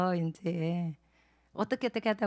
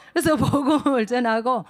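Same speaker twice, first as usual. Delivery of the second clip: laughing while speaking; 4 semitones higher, 8 dB louder than usual. Real, not acted.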